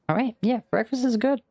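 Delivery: high, bright praise of the food; excited. Acted. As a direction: rave about breakfast. Narrator raves about breakfast.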